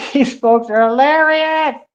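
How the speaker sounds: high-pitched laughing disbelief. Real, not acted. Laughs at viewers' comments.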